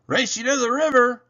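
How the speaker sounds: high, loud, fast challenge; shouted challenge. Acted. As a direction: call out a dare.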